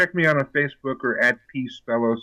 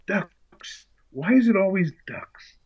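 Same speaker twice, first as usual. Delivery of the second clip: a light mock whisper of the exasperated movie line, pitched higher; imitating Sigourney's film line; 9 semitones higher, slower than usual. Actor quoting her film line to Sigourney Weaver, hushed mock whisper. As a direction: toss off the famous ducks line in a breathy hush. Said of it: "At the moment of the film where you go".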